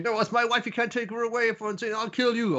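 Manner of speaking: high-pitched, sustained protest; mock distraught husband. Retelling a man whose wife is taken away, high mock-distraught voice. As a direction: act out a desperate objection, pitched well up.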